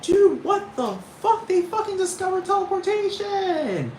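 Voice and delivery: high, loud, stretched-out disbelief; freaking out. Acted. People running around freaking out, high frantic yell. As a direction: exclaim it in a high, astonished shriek.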